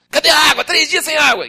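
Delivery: loud high complaining shout; angry crowd. Acted. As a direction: demand water angrily.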